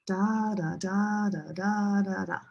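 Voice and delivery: "DAA da DAA da", long and short beats alternating; English stress rhythm. Shown as a demonstration. Teacher showing music of English, long-short stressed "da" rhythm. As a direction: swing between long strong "da" and short weak "da". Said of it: "This is the music of English".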